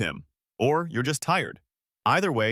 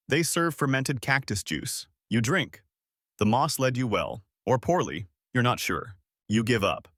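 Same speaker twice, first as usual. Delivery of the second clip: ordinary delivery, nothing marked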